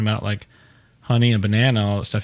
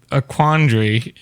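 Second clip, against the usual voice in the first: ordinary talk, nothing marked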